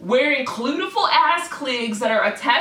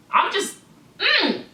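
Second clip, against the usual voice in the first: ordinary talk, nothing marked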